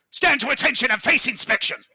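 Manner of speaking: high, loud military bellow; booming command. Acted. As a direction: bark it like an officer on parade. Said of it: "booms Laurent".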